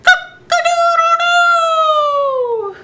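high, drawn-out cock-a-doodle-doo; a rooster waking the farm; rooster crow. Acted. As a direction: crow like a proud rooster at dawn. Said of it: "the rooster crowed".

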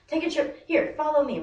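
high, loud mock invitation; mock-patient ordering. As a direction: lead them off with exaggerated patience. Acted exasperated order to talker.

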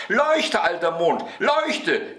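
put-on high, insistent little boy's yell; shrieked command. A narrator's reading. Boy orders the old moon to shine, high shriek. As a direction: squeal orders like a greedy child. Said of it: "schrie Häwelmann".

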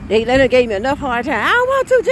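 loud, rising to a high wail; whiny protest. Acted. Believer refusing to love enemies.

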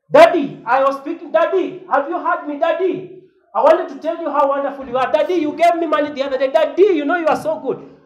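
put-on high, loud, fast flattering child; gushing child to father. Pastor mocking a child shouting praise at his father, high and gushing. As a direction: fawn over daddy with loud high pleas. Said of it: "I go to my father at home in the village and say".

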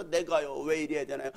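put-on soft, plaintive drunkard's complaint; mock woeful confession, pitch climbing; imitated lament. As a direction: moan softly like a caller pouring out troubles. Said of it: "막 하소연을 하면서".

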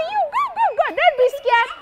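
high-pitched coaxing push; urging others. Acted. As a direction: nudge a friend forward. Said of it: "They say".

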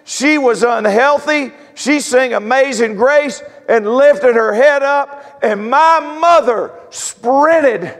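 high, drawn-out sermon climax; full-force preaching. Acted. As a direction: preach her victory, stretching the big words. Eulogizing mother in full preaching.

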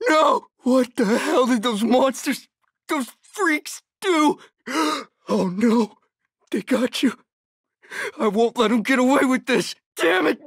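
high, anguished outcry; horrified grief. Acted. Survivor finding what the freaks did to a friend, anguished disbelief. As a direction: cry out in shock at a terrible loss. Finds a friend killed by monsters.

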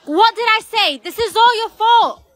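high-pitched accusing outburst; agitated, pitch jumping well up; angry blame. Acted. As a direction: throw the blame at your sister. Sister blames her after sinking.

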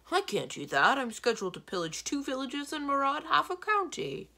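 loud giant objection, climbing high; protesting giant. A narrator's reading. Giant refusing to stay home in bed, loud protest. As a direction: object loudly and indignantly.

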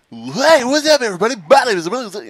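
high, loud, overexcited streamer greeting; hyped youtuber. Retold or imitated. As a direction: ham up an overhyped YouTuber intro. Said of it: "he's like".

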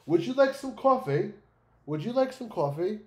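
high, loud put-on European accent; mock a European accent with exaggerated high pitch. Streamer mimics a video narrator he can't listen to, mock European accent. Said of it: "like, European accent like that".

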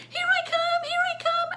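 very high, loud squealed call; fleeing cat. Acted. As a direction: squeal it out as you dash away. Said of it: "she goes".

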